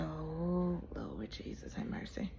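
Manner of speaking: hushed dread at the recoupling; whispered prayer. Retold or imitated. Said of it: "I said".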